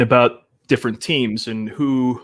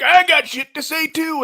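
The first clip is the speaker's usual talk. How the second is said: much higher, mocking the brash guest; rowdy guy impression. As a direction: squeal it like a pushy loudmouth barging in. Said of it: "he was just like".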